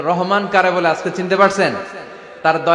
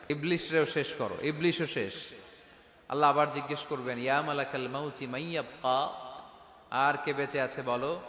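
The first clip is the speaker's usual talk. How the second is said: much softer, almost breathless; hushed; 3 semitones lower, 13 dB quieter than usual. Acted. Preacher voicing God and the angel after all have died, hushed. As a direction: drop the voice to a hush for the last souls.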